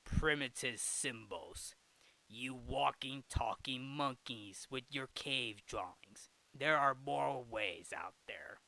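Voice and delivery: low, slow, menacing villain; slow, scornful evil mastermind. Acted. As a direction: draw it out in a sinister low voice. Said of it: "this evil voice is saying".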